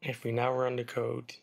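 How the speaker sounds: usual speaking voice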